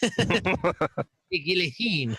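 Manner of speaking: slow, drawn-out mock French accent; French chef. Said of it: "We're working on the Macron".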